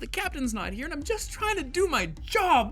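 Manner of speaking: high, loud, fed-up rant; she's at the end of her rope; exasperated officer. Acted. Officer scolds meddling kids, freaking out. [name] snaps at kids on computer.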